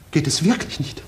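anxious whisper; whispered plea. Acted. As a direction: ask under your breath, worried. Backstage worry before the show. (ängstlich geflüstert)

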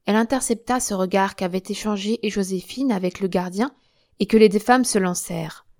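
plain reading voice

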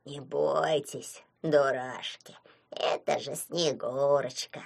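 low, soft and very slow; gentle reassurance. A narrator's reading. Calming shy imps about the Snow Maiden, tender and slow.

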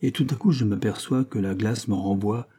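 usual speaking voice

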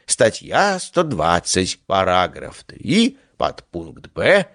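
slow chanted reading like a schoolboy; singsong recitation. Acted. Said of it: "начал читать нараспев, как школьник на утреннике".

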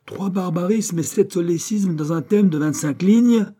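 high, loud indignant outburst; wrathful, swelling. A narrator's reading. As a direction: swell with outrage at the pupil. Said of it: "en se renflant encore la voix courroucée". (voix courroucée qui enfle)

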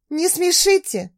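high, scornful snort; snorting scoff. Acted. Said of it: "Фыркнула".

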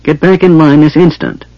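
high, lightly shocked command; older girl's scolding cry, short of shouting. A narrator's reading. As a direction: order a child back, scandalized. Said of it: "cried the oldest girl, shocked".

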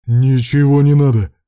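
loud, irritated bark; barked refusal. A narrator's reading. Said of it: "рявкнул Руслан". (рявкнуть раздражённо)

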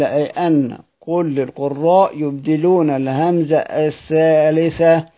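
normal talking voice

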